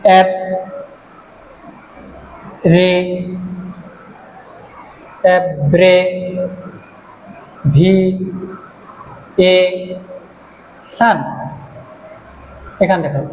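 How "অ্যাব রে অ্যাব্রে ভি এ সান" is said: slow dictation of অ্যাব্রে-, ভি-, এ-, সান in separate pieces; long pauses between অ্যাব্রে-, ভি-, এ-, সান; syllable by syllable. Shown as a demonstration. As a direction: draw out and isolate each syllable up to সান. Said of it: "পাঁচটা syllable আছে".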